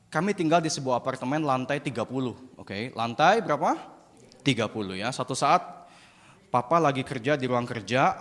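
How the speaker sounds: ordinary talk, nothing marked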